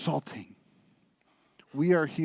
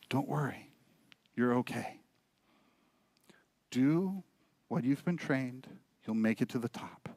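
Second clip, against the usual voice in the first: much softer, calm reassurance from out of sight; soft hidden voice; 3 semitones lower, 9 dB quieter than usual. Acted. Father's unseen voice calming his lost son, soft and low. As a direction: hush it like a voice from the trees.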